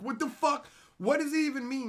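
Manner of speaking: usual speaking voice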